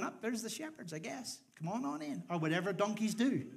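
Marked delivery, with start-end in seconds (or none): 0.0-2.2 lightly voiced donkey, higher and offhand; casual donkey doorkeeper. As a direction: wave them in as an easygoing donkey. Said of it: "a donkey looking out the window going".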